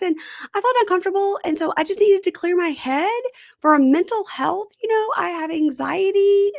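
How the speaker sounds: high, girlish imitation of her apology; squeaky put-on voice of a nervous girlfriend; mimicking girlfriend. Retelling to co-host, imitating the girlfriend in a high put-on voice.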